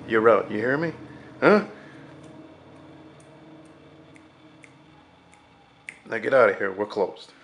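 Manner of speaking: loud, threatening boss voice; angry warning. Retold or imitated. Darnell threatens Arnie with probation, loud and angry. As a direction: put him on notice, hard and loud. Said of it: "He says".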